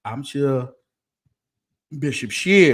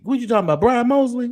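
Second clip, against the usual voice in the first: higher, louder offended retort; playfully offended, raised pitch and volume; mock indignation; 12 semitones higher, 8 dB louder, faster than usual. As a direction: act outraged at the accusation. Mock outrage at wig question.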